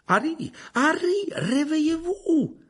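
appelé haut, fort et lent; appel pressant. Acted. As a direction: Lupin secoue Harry, appel inquiet. Lupin réveille Harry inconscient.

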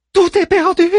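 very high theatrical lament; reciting in high voice. A narrator's reading. Manmut recites the sailors' part, high stage cry. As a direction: declaim the disaster at high pitch. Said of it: "récita Manmut".

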